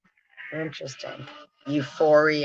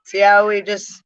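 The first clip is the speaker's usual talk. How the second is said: laughing; 4 semitones higher, 4 dB louder than usual. Real, not acted. Laughing at own pun.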